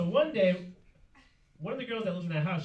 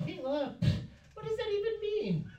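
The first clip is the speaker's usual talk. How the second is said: high mock girl voice; 13 semitones higher, 4 dB quieter than usual. Girl questions the gangbanger.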